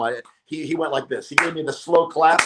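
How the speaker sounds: ordinary talk, nothing marked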